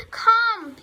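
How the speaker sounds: high, loud falling call; one big drawn-out holler; calling an animal. A narrator's reading. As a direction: call the pig over loudly. Farmer calls the pig.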